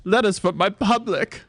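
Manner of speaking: grand British diva, pitched high; high, theatrical British delivery; British accent. Acted. Retired actress boasting to her children, high British accent. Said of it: "my accent is superb".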